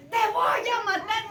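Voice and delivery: furious threat. Retold or imitated. Brother Esau vowing to kill Jacob, shouting. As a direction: bark it like a brother out for blood.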